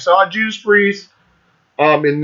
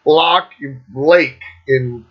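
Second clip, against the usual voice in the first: normal talking voice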